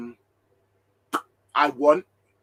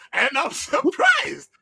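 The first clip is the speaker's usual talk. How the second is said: laughing disbelief; 7 semitones higher, 7 dB louder, slower than usual. Real, not acted. Laughing at Jez falling asleep.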